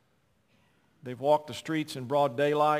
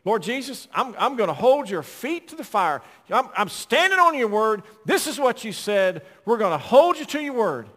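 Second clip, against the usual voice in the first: imitating a bold, loud praying deacon; lightly voiced fiery prayer; fervent prayer imitation; 6 semitones higher, 7 dB louder than usual. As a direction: hold God to his word, raising the voice. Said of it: "he scared me".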